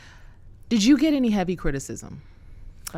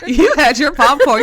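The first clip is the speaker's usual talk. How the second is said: said through a laugh, louder; 5 semitones higher, 13 dB louder than usual. Real, not acted. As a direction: laugh while teasing. Laughing at the story.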